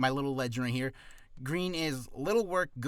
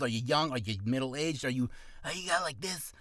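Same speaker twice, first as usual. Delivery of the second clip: ordinary delivery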